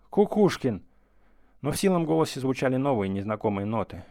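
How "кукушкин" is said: booming call, high and loud. A narrator's reading. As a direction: call out across the rooms. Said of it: "пронёсся зычный зов".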